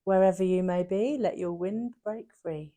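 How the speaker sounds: very low, very soft recital; solemn repeated saying. Shown as a demonstration. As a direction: drop right down, slowing at the end. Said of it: "can you just say that again?".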